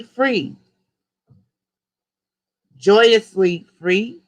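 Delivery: rising, declaiming call with long gaps for repeating; slow decree. Acted. Leader calling out a decree for the group to repeat, slow and rising. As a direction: lift each "free" word by word, slowly.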